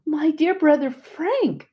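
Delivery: high, slow, joyful greeting; joyous cry. A narrator's reading. Narrator greeting her dead brother-in-law, joyous cry. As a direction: call out his name in glad surprise. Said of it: "I cried out joyously".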